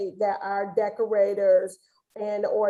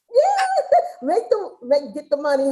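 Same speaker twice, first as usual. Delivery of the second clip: laughing; 5 semitones higher, 4 dB louder than usual. Retold or imitated. Playful boast, laughing.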